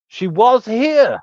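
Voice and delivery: put-on high, very slow drunken drawl; drunk slow pointing. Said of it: "he points and he goes".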